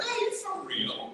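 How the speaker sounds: can't believe what he's hearing; incredulous. Retold or imitated. Incredulous reaction to brother.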